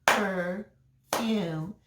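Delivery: "Perfume" split into two separate beats, per and fume; "Perfume" broken evenly as per, fume; clapped out syllables. Shown as a demonstration. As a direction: clap out "Perfume" slowly, pausing between syllables. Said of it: "Let's clap it out".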